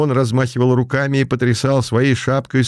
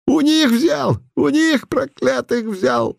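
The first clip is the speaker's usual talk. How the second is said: put-on high mumbling old man voice; toothless mumble; slower than usual. Putilin in old man disguise.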